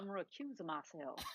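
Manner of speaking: put-on lightly drawled Southern accent, quiet; a hint of a Southern Jeff Sessions, softly; mild Southern accent impression. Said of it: "in a Southern accent".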